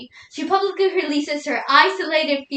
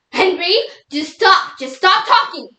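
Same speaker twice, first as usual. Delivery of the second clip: louder, higher scolding of a partner; mock exasperation; 4 semitones higher, 4 dB louder than usual. Wife telling Henry to stop talking in a minor fight, exasperated. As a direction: cut him off, fed up, punching each stop.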